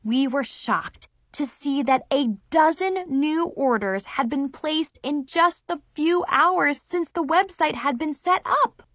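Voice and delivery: plain reading voice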